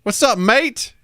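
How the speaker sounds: high, hammed-up British pleasantries; badly faked British chirp; put-on British accent. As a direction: pile on fake British greetings in a high voice. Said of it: "a accent that long".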